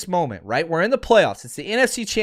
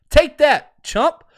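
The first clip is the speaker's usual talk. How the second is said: taunting shout; 8 semitones higher, 6 dB louder, much slower than usual. Real, not acted. Taunting the rival quarterback.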